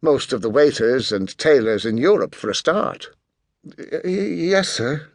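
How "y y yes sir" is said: halting, flustered waitress; stuttering reply to an intimidating customer; nervous stammer. A narrator's reading. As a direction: stumble over the first word, higher and timid. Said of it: "stammered the unfortunate waitress".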